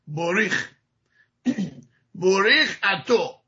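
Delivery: بوریخ اتو said slowly, with an o ending. Shown as a demonstration. Teacher demonstrating the Eastern European form. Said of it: "شده بوریخ".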